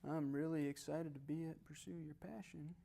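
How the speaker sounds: slow, soft, barely interested; unexcited delivery. Shown as a demonstration. Speaker showing tonality to audience, slow and unenthusiastic. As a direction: drag it out quietly with no excitement. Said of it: "say the same thing".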